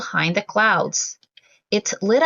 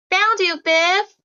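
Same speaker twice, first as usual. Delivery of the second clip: calling out; 8 semitones higher than usual. Acted. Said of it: "he called".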